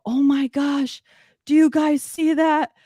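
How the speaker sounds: high, excited call to the friends; calling out in retold wonder at the orb; amazed call. Narrator to her friends, a high excited call.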